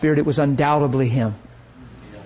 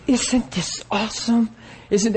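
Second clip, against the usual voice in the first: breathy-light, higher gushing voice, softer; flaky gushing lady; 6 semitones higher, 4 dB quieter than usual. Retold or imitated. Preacher imitates the flaky woman at the meeting, higher, softer gushing. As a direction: gush like someone swept away. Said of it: "she just got different".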